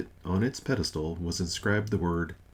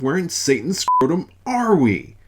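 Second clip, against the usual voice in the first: booming slow roar, pitched up in a put-on voice; gruff bellow; 10 semitones higher, 9 dB louder than usual. Old god waking in Fresno, bellowing at his wife. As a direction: let it thunder out as an outraged old god.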